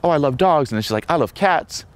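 a hint of an eager girl; lightly imitated enthusiastic girl. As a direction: gush lightly, as if quoting her. Imitates gushing girl in example.

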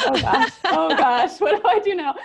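high, loud flustered quote with laughter; comic high-pitched fluster; mock panic. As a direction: play up being overwhelmed, laughing. Acts out panicked first days on job.

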